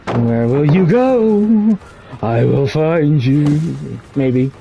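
slow, drawn-out stalker taunt; playful menace. Pretend. Killer hunts the one who flees, playful menace. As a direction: stretch each word, teasing the prey.

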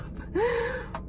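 short high laugh-sob; real laugh catching in the throat; teary laugh. Real, not acted. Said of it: "emotional laugh".

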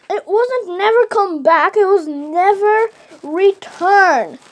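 ordinary delivery, nothing marked

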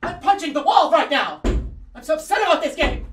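high, loud hammed-up rage; comic tantrum. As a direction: shriek it as an over-the-top joke tantrum. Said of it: "I'm just trying to make it, like, funny".

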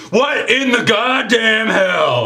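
high, loud, slow outraged shout; shocked sheriff. Acted. Sheriff sees the yeti smash the snowman, yelling. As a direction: yell it in total disbelief. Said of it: "Sheriff Nash is like".

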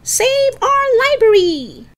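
high, loud protest shout. Acted. Lisa starts up the protest, shouting. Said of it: "then started shouting".

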